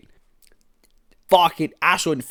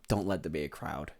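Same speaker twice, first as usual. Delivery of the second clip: quiet dread; 8 semitones lower, 13 dB quieter than usual. Real, not acted. Dread looking down.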